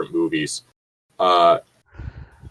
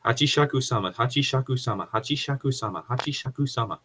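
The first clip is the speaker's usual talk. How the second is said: repeated over and over, fading softer each time; tongue twister on request; 4 semitones higher, 7 dB quieter, faster than usual. Pretend. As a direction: chant the name again and again, dying away. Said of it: "Say that five times fast".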